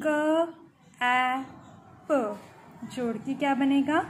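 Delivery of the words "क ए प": क, ए, प as separate short sounds with gaps; phonics sounding out. Shown as a demonstration. Teacher building a word for pupils, क, ए, प sounded separately. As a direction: sound out each of क, ए, प on its own. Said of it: "जब इसको जोड़ के पढ़ते हैं".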